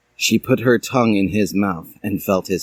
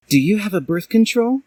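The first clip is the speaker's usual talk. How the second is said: put-on much higher girl voice; Dally; 11 semitones higher than usual. A narrator's reading. Dally asking Hiei, much higher voice. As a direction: ask worried. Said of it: "asked Dally".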